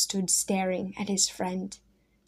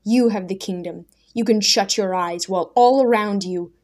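louder, rising bitter reproach; a young man's scornful outburst at his friend; bitter accusation; 6 dB louder than usual. Acted. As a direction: throw the accusation at him. Daniel bitterly accuses Simon.

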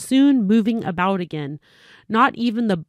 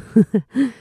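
short real laugh at her own slip; a quick self-amused laugh; amused chuckle; 3 semitones higher, 4 dB louder than usual. Real, not acted. Reader laughing at her own stumble, light chuckle. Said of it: "laughs at own slip".

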